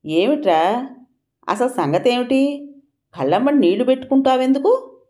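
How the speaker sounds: high, bewildered questioning; puzzled. A narrator's reading. Nalini asking the tearful servant what happened, puzzled and high-pitched. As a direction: press for an answer in rising confusion. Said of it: "నళినికి ఇదేం అర్థం కాక".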